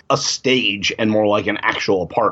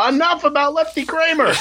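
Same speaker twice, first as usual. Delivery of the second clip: much higher, hammed-up exasperation; imitating someone fed up, pitched way up; mock exasperated outburst; 14 semitones higher than usual. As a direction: cut off the chatter in a high mock cry. Exasperated outburst acted from the show.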